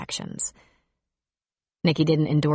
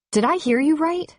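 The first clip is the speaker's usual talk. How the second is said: incredulous; 10 semitones higher than usual. Acted. Confronting Bob.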